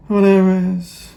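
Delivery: high, loud, throwaway burst; dismissive exclamation. Real, not acted. Vlogger waving away a legal jargon update, loud dismissive outburst.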